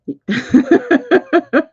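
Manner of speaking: a high burst of delighted laughter; high excited laugh. Real, not acted. Reader laughing at a yes from the cards.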